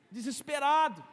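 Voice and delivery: ordinary talk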